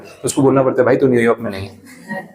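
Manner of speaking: ordinary delivery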